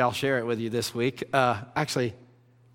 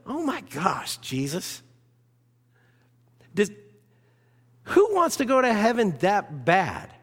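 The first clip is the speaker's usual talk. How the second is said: mock shocked reaction; 8 semitones higher than usual. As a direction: act scandalized by Jesus. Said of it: "we might hear that and go".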